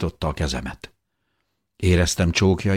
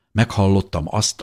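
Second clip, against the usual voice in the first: usual speaking voice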